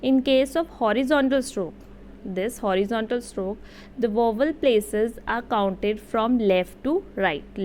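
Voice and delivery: very slow, deliberate dictation pace; careful classroom reading of a rule; slow read-aloud. Shown as a demonstration. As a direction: read it out word by word, slowly. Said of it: "मैं read out कर देती हूँ".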